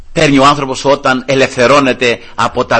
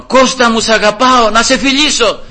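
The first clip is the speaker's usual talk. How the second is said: joyful affection; 8 semitones higher, 4 dB louder than usual. Retold or imitated. Father calling old enemy joyfully.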